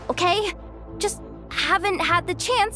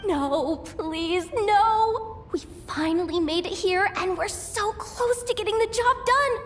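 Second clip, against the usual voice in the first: panicked pleading. Acted. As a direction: beg and wail as if the end has come. Believes they will die.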